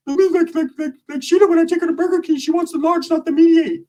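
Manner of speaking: high put-on voice, explaining dimly; dim cousin. Cousin explaining the word to him in a high voice. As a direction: explain earnestly and wrong. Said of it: "what does that mean".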